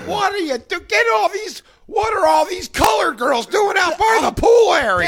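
mock grumpy old man. Parodying the old father at the pool, outraged shout.

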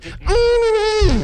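high, sustained guitar wail; squealing mock guitar note; mouth-made guitar noise. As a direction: mimic a guitar lick with your voice. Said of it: "it's an inflatable guitar".

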